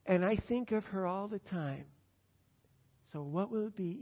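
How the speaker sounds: ordinary talk, nothing marked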